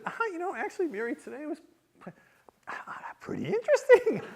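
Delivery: high-pitched, halting evasion; stall, then squeeze out the admission; acted-out awkward casualness; sheepish understatement. Nervously understating day to wife.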